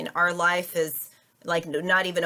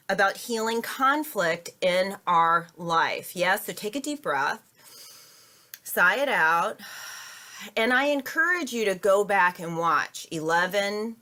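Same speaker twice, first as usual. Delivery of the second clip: normal talking voice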